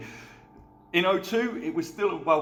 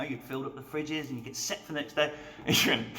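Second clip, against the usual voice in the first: normal talking voice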